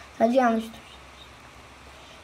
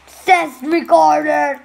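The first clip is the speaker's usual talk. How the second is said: loud, drawn-out playful call; loud announcing; 3 semitones higher, 6 dB louder, much slower than usual. Pretend. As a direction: call it out slowly and loudly. Same name said two contrasting ways. (yüksek sesle, uzatarak seslenme)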